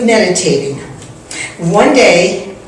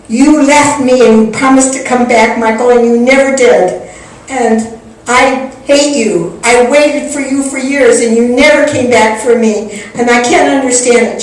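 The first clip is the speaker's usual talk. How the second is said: hurt accusation; 3 semitones higher than usual. Retold or imitated. Friend accuses her of never coming back, hurt reproach. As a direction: let the accusations pour out emphatically.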